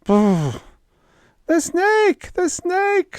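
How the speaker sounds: high shrieking terror; screaming. Acted. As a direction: scream the warning in panic. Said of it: "screamed Marcia".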